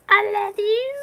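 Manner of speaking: high cartoon voice, drawn out; squeaky love. Answering madam's sweet hug, squeaky love. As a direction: coo it in a tiny silly voice.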